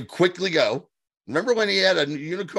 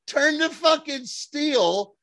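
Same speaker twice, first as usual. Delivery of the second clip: high-pitched, drawn-out disbelief; exasperated yell at the screen; 13 semitones higher, much slower than usual. Retold or imitated. Fan yelling at the TV, high and slow in disbelief. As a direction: stretch it out, pleading with the TV. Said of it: "And I'm like".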